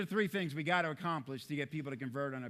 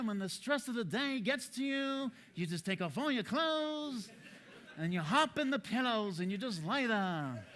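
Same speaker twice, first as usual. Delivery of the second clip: Chris Farrell impression; 7 semitones higher than usual. Speaker describes a calm colleague in a mock high dreamy voice. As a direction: drift along as if floating on pillows. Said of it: "But then there's Chris Farrell".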